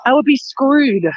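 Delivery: ordinary delivery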